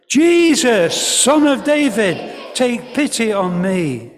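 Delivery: high, loud desperate-plea call; loud, high call that eases at the end. Acted. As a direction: cry out over the crowd. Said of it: "But he shouted even more".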